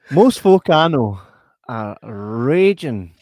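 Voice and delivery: loud, slow, drawn-out punchline; emphatic retort. Retold or imitated. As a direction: stretch RAGING right out. Own retort to the serious room.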